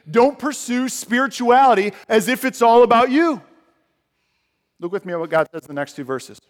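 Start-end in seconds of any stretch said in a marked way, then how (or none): 0.1-3.4 loud, higher command; preacher voicing God's loud warning. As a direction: proclaim the warning.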